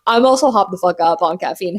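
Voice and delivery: normal talking voice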